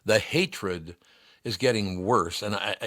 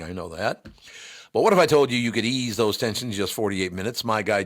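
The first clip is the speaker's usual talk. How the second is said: usual speaking voice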